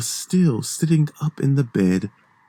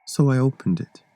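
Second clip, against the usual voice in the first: plain reading voice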